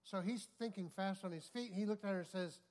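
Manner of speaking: ordinary talk, nothing marked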